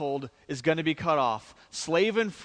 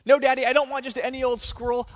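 higher, louder, fast spoiled whine; spoiled daughter refusing her father's offer, high and fast; shrill brat protest; 7 semitones higher, 4 dB louder, faster than usual. Retold or imitated. Brat escalates her demand.

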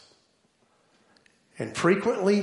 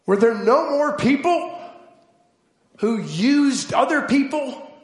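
much higher and louder preaching climax; preaching at full force; 8 semitones higher, 4 dB louder than usual. Acted. Preacher driving home rhetorical questions to congregation, full-force preaching. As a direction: build the rhetorical questions like a pulpit crescendo.

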